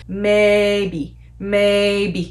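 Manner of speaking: MAYBE stretched out on one level pitch; both syllables of MAYBE held even; monotone. Shown as a demonstration. Teacher modelling the first tone with an English word, monotone. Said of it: "I'll just call it the monotone".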